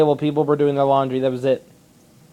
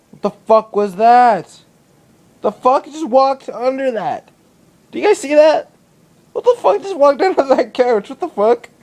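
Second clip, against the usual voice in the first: high-pitched, freaked-out reaction; startled disbelief; 9 semitones higher, 3 dB louder than usual. Real, not acted. Vlogger spotting a huge bug under the couch, startled. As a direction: blurt it out in real shock at something that just moved.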